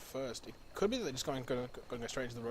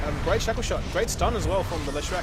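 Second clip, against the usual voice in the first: loud, fast-building casting call of the fight; hyped live esports commentary; excited play-by-play; 4 semitones higher, 9 dB louder than usual. Acted. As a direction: ride the action as it unfolds, loud.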